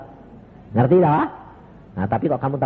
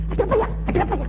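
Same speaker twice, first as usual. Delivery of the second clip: startled wife; much faster than usual. Retold or imitated. Wife woken by the noise.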